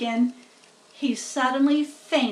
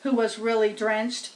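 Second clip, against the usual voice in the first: usual speaking voice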